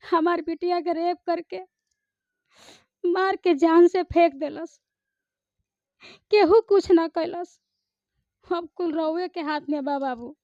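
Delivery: high, loud plea with long dramatic pauses; film dialogue performance. Shown as a demonstration. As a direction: accuse and implore, phrase by phrase. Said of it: "उसका जरा एक dialogue बोलिए".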